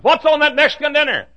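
high, loud excited question; hammed-up hungry excitement; eager demand. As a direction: blurt it out urgently, high and loud. Eagerly phoning room service.

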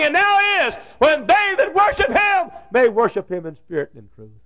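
high, loud, ringing preacher's cry; full-force preaching. Acted. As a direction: throw the Bible dialogue out to the whole hall at the top of the voice. Preaching at full force, voices Jesus.

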